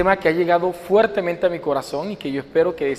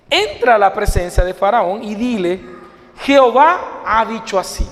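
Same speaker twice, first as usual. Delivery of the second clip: emphatic, raised public Bible reading; scripture reading; 3 semitones higher than usual. A narrator's reading. Preacher reads God's command to Moses for the congregation, proclaimed scripture reading. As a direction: proclaim the verses in separate, weighted phrases.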